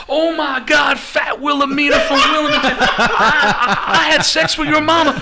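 high, loud mock shouting; hammed-up amazement. Tommy Rich recognising the waiter's mother's name, hammed-up shout. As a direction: shout it in imitated delighted recognition, stumbling over words. Acted shock at hearing the name.

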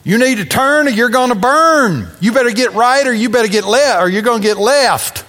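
high-pitched, mock revival-preacher warning; old-timey evangelist. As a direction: imitate an evangelist calling sinners out, pitch pushed up. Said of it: "old-timey evangelists used to use".

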